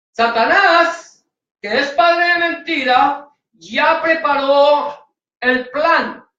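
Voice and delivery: a preacher slowly stressing Satan's plan; slow emphatic. Acted. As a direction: drag it out as a warning.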